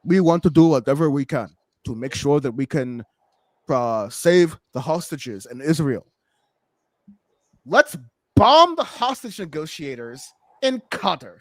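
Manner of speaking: mock a smooth statesman's promise; Netanyahu impression. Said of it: "his B B voice was like".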